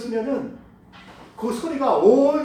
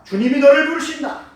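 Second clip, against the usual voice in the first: excited announcement; 5 semitones higher, 8 dB louder than usual. Acted. Crowd telling blind man.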